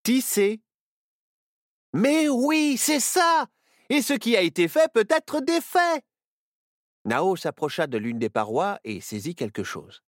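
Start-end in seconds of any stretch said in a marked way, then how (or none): 0.0-6.0 high, loud eureka burst; light up with the idea; girl figuring out the escape; excited realisation. Acted.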